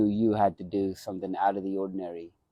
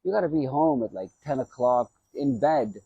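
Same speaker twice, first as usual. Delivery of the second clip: higher, quicker stern coach voice, imitating him; coach's warning; 6 semitones higher than usual. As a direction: lecture the young fighter like his old trainer. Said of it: "He's like".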